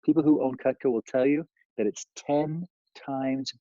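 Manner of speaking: slow, deliberate sales pitch; slow it down to land the point. Shown as a demonstration. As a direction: customer pitch read slowly for emphasis. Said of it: "sometimes you wanna slow down".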